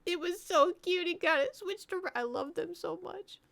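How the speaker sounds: high-pitched fond squeal of words; adoring gushing. Real, not acted. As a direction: gush over them, way up high. Gushing over the group she loves.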